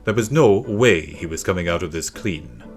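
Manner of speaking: usual speaking voice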